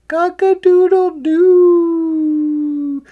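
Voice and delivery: high, loud, drawn-out crow; rooster crow. A narrator's reading. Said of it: "when the cock crowed".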